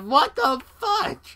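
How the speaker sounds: high, loud startled what the fuck; shocked outburst. Retold or imitated. Gamer startled by enemy Lee Sin, shrieking high and loud. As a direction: yelp it in sudden disbelief.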